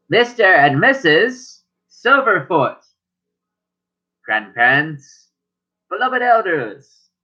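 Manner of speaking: slow, stately, over-formal herald; grand servant announcement. Acted. Servant formally announcing grandparents to the family, slow and stately. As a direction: announce them like a proud butler to the house.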